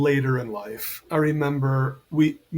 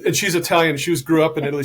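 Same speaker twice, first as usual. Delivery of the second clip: ordinary delivery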